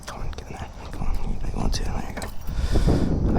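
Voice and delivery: whispering. Real, not acted. Coaxing items into the bag.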